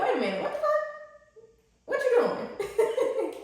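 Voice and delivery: high, laughing what-the-heck, slowing down; shocked disbelief. Retold or imitated. Speaker to viewers, imitating shock at a gentle partner, laughing high. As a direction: act stunned that someone treats you well. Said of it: "you gonna be like".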